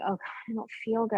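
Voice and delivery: quick, soft and drained; weak murmur. Retold or imitated. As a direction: mutter it like you're fading. Retelling feeling ill on walk.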